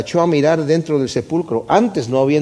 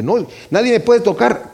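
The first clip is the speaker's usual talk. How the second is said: burla; 7 semitones higher than usual. Retold or imitated. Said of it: "y era como que".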